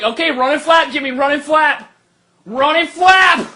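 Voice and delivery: high mock cheer, the last run slow and loud; a father urging his boy off the roof; egging him on. Dad cheering his son off a roof, high and mocking. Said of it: "I'm like".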